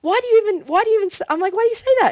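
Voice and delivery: high, loud flustered questions; stunned disbelief. Retold or imitated. Stunned by friend's prediction.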